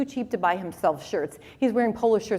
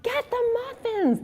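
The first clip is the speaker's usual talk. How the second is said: mock excited shout; 14 semitones higher than usual. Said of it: "he's shouting to my mom".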